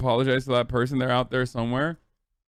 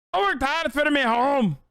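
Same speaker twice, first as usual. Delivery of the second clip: much higher, hammed-up Scottish whine with "worked" stressed; squeakier Scottish accent; 11 semitones higher than usual. Streamer retrying the Scottish line in a squeakier mock accent. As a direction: push the fake Scottish voice up even further. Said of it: "I'll do Scottish".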